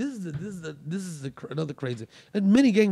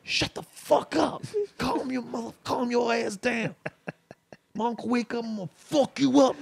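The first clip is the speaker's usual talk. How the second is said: upset; 8 semitones higher than usual. Retold or imitated. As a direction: hiss a threat but keep it down. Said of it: "And then Trey got upset".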